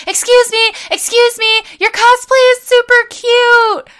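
high, loud excited call over the table; screaming across the room. Retold or imitated. Fan calling out to a passing cosplayer, high loud shout. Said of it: "just screaming".

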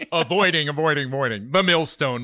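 booming title with echo; echo effect. Pretend. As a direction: announce it like a big echo. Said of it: "The echo thing".

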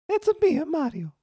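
high-pitched cartoon Italian accent; Mario. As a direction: squeak it out in a mock Italian accent. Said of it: "try it with an Italian accent".

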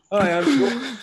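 high, loud mock introduction; George. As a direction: put on a big, high-pitched hello. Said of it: "he's gonna go".

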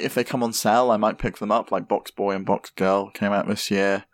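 usual speaking voice

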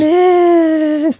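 squeaky high giggle; a short high-pitched laugh. Real, not acted. Reacting to panda remark.

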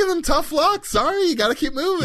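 high, sing-song fake sympathy; mock sympathy. Mock DM refusing players.

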